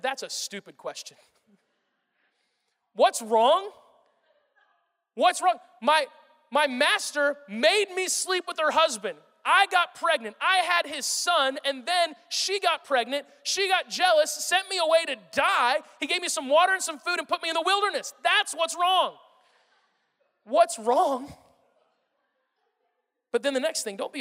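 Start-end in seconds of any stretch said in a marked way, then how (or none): 2.9-3.7 loud, incredulous rising question; incredulous echo. Acted. Hagar throws the angel's question back, incredulous. 5.1-19.2 indignant outburst. Acted. Hagar telling the angel what she has suffered, indignant. 20.4-21.3 raised, louder, affronted question; incredulous echo. Acted. Hagar repeating the angel's question, incredulous.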